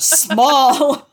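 loud, drawn-out SMALL; mock emphasis. Repeats the word for comic effect.

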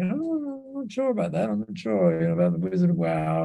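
small, high, soft voice of mock reluctance; hesitant doubt. Speaker recalls doubting his new title at the back of the room, hesitant. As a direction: voice his doubt high and quiet, almost to himself.

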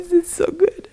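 high soft teasing coo; falsetto. Pretend. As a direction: breathe it out way up high.

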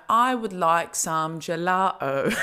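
drawn-out mock British English; badly faked, very slow British accent. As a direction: stretch each word in exaggerated British. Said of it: "they talk really slowly".

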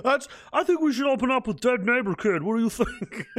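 high, playful put-on voice for a joke suggestion; teasing mock pitch.

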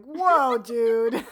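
loud, high mock-shocked whoa; big playful disbelief; stunned reaction. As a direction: react as if taken aback. Stunned reaction to character's confession.

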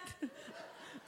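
airy wheezing laugh; real laugh; breathless laugh. Real, not acted. Laughing about basket story.

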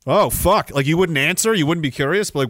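ordinary talk

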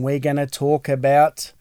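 ordinary delivery

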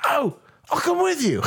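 put-on high, laughing, eager drunk offer. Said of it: "And he's like".

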